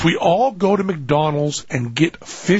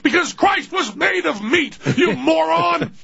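much higher, frantic parental shouting; yelling parent; 13 semitones higher than usual. Acted. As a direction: shout the kid down in a high, strained voice. Parent yelling back at kid.

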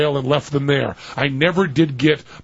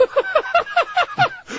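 a sudden shrieking giggle; high squealing laugh. Real, not acted. Host cracking up on air at the story, squealing laugh.

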